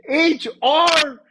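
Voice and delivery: loud high incredulous yelp; retold shocked outburst; outraged disbelief. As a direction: explode on the echoed question. Acting outraged echo of boss.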